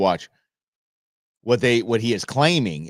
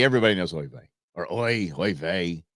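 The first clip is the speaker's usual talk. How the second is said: ordinary talk, nothing marked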